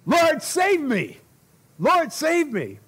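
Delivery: high, drawn-out acted cry for help. Peter sinking, cries to Jesus for rescue, desperate acted shout. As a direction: cry out loud and long as if sinking. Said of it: "cried out".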